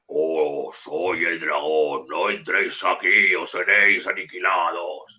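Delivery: slow, booming dragon warning; deep dragon voice. Retold or imitated. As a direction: growl it out slowly like a dragon guarding its lair. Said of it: "se oye una voz grave". (voz grave y lenta de dragón)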